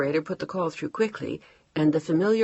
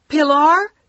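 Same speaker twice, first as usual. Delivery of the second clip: higher, louder frantic shout; shouting into phone; 11 semitones higher, 12 dB louder than usual. Acted. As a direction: shout the name in panic. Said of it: "she shouted louder".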